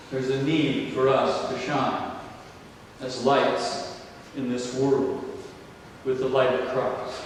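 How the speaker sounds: normal talking voice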